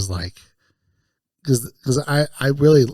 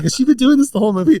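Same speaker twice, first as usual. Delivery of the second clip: incredulous; 14 semitones higher, 6 dB louder, much faster than usual. Retold or imitated. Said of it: "I was like".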